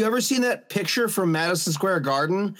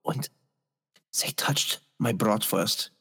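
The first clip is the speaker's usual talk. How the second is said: unvoiced mock-secret whisper; hushed slow confiding whisper; 8 semitones lower, 4 dB quieter, slower than usual. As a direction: whisper the punchline slowly. Lewd joke told in hush.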